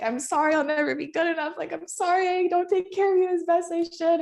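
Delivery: high, tearful pleading apology; imitating a tearful high plea; retold weeping plea. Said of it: "And I was just weeping".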